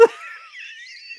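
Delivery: very high wheezing laugh; high squeaky laughing fit; helpless laughter. Real, not acted. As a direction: laugh till only a squeak comes out. Cracking up at the figure.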